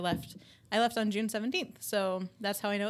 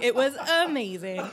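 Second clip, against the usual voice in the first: laughing; 3 semitones higher, 5 dB louder than usual. Retold or imitated. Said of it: "I was like".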